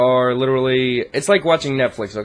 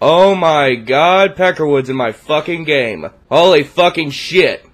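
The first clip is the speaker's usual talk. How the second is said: excited; 3 semitones higher, 7 dB louder, slower than usual. Real, not acted. Rival player joins his game.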